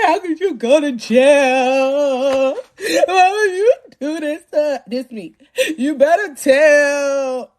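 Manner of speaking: slow, stretched-out hammed-up crying; hurt mock wail. Hurt woman to jailed baby daddy, mock wailing. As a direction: drag the words out in a mock sobbing wail. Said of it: "I'm so hurt. I'm like".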